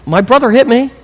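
high-pitched mock child complaint; tattling kid. Kid to parent, blaming brother, high mock protest. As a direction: imitate a child telling on a sibling. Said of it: "your kids give you that".

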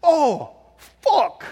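put-on very high, loud Chinese-accented realization; high-pitched Chinese-accented "aha"; waitress suddenly understanding. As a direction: imitate a Chinese waitress finally getting it, "fok" with no R. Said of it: "and then she says".

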